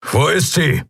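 sharp worried question; urgent question. A narrator's reading. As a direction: demand an answer, tense. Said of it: "Keru sprang auf".

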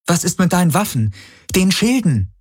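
high-pitched pressing questions; urgent heroine. A narrator's reading. Kirai asks the ship about weapons, urgently pressing.

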